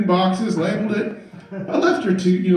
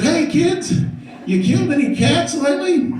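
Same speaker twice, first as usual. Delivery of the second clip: teasing dad; 7 semitones higher than usual. Retold or imitated. Dad teasing goth kids.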